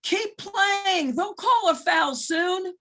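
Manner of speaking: high, loud sideline yell from an imitated coach; mock bench shout. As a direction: call it across the court like a sneaky assistant coach. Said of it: "where the coach yells out at their part".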